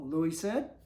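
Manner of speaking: usual speaking voice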